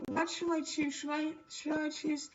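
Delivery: usual speaking voice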